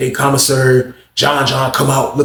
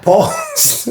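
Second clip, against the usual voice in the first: mocking squeak. Mock long drawn pause.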